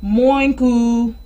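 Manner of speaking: half oink, half moo blend; muddled cow sound. Shown as a demonstration. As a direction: squash moo and oink together. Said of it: "this is how the cow went".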